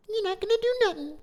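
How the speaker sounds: hammed-up squeaky teen taunt; squeaky mock taunt. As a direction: squeak it out like a cocky kid. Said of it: "we speak how we speak".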